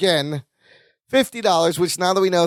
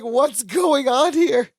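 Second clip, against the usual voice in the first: confused burglar; 8 semitones higher, much slower than usual. Retold or imitated. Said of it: "he's like".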